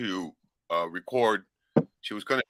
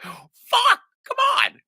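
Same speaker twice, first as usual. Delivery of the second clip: a much higher, louder exasperated cry; 7 dB louder, much slower than usual. Retold or imitated. Son watching mom's endless plane footage, shrill exasperation. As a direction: groan it out, fed up and shrill. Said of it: "So I'm like".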